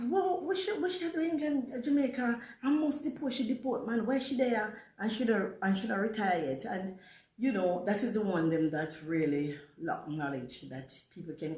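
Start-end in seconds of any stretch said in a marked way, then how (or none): 0.0-6.6 higher-pitched mock incredulity; acted high-pitched interrogation; nosy questioning. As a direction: imitate a puzzled local prying, pitched up. Mimics nosy locals questioning her return.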